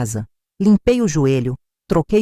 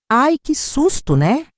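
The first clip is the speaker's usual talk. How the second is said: higher, drawn-out token concern, lightly voiced; absent-minded sympathy; 7 semitones higher, slower than usual. A narrator's reading. Distracted daughter brushing off her mother's worrying story, mildly absent-minded. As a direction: react as if your head is somewhere else. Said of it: "com um tom de quem estava com a cabeça em outro lugar".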